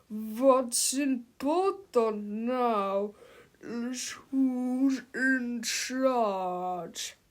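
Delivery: slow, menacing giant voice; giant robot villain. A narrator's reading. Super Mega Tippy coldly takes control from his double. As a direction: loom over him, every word drawn out.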